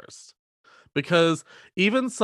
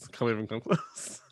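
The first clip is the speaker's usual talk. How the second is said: ordinary delivery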